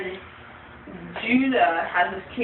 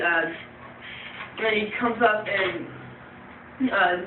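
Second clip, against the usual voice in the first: ordinary talk, nothing marked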